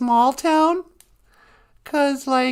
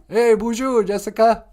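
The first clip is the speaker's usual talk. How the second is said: ordinary talk, nothing marked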